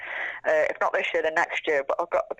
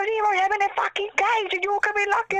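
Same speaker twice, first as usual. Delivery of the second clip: put-on indignant boyfriend; 14 semitones higher than usual. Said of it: "I go".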